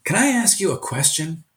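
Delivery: a hint of an eager student; student asking. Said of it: "And he said".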